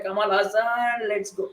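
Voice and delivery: stretched-out mock holler; mock fan scream. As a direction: imitate screaming for a film star, held back. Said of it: "चिल्ला रहा हूँ मैं गला फाड़ के".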